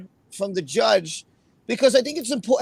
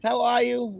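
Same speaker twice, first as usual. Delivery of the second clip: usual speaking voice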